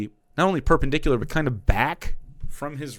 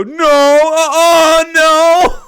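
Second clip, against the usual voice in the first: high, loud, drawn-out fake crying; mock whine; 14 semitones higher, 12 dB louder, much slower than usual. Mocking how right wingers react, a whiny fake cry. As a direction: wail it like a baby throwing a tantrum. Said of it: "all they're gonna do is go".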